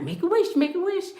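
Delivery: high, eager quoted urging; voicing her daddy. Dad at the sight of eleven eleven, imitated high urging. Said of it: "he'd be like".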